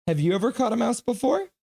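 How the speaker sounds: high excited dog talk. Real, not acted. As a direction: pitch it way up to get the dog excited. Asking the dog twice.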